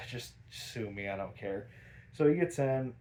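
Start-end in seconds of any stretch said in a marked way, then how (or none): 0.0-1.7 low, much softer uncaring mumble; bored Joker. Retold or imitated. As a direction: brush off the landlady. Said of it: "And Joker's like".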